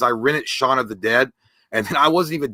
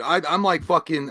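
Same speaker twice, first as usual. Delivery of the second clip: normal talking voice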